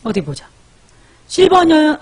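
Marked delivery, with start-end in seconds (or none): 1.3-2.0 high, loud call of a viewer's name. Real, not acted. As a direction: shout her name out to get her attention. (높고 큰 소리로 부르기)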